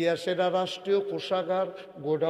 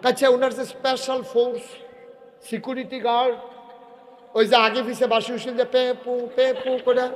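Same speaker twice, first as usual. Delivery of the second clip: loud, high-pitched sermon delivery; full-force preaching; 8 semitones higher, 10 dB louder than usual. Acted.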